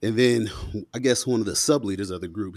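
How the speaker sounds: normal talking voice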